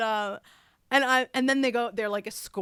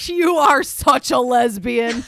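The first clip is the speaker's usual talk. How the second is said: much louder, slow teasing jab; teasing host jab; 3 semitones higher, 11 dB louder, slower than usual. Retold or imitated. Host teases the actress about her lunch, loud and slow. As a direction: tease her outright for the laugh. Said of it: "and Andy goes".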